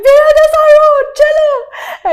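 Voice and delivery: high, loud tearful wail; mock sobbing at the driver; crying. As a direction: sob the order to go. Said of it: "I'm like".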